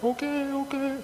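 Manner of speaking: nervous high-pitched giving in; freaked-out okay. Retold or imitated. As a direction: squeak like someone cornered. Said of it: "I was all freaked out".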